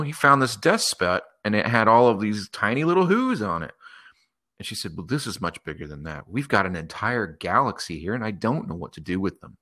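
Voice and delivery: plain reading voice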